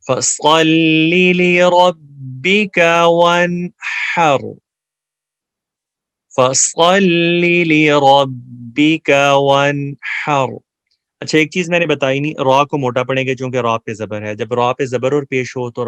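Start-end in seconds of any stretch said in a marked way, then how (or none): 0.1-10.5 slow, loud chanted recitation; Quran recitation. Shown as a demonstration. Teacher recites the verse slowly for students to repeat. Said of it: "میرے پیچھے آپ پڑھیں گے".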